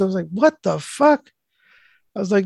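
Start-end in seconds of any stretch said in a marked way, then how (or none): 0.3-1.3 high, drawn-out incredulous reaction; retold disbelief. Mailman asked to paint her fence, mock disbelief. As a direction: stretch it out in mock disbelief, pitched up.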